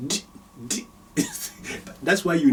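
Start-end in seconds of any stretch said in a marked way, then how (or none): 0.1-0.9 bare stopped d sound; a bare consonant d; sukun d. Shown as a demonstration. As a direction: cut the d short with no vowel. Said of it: "that will be d".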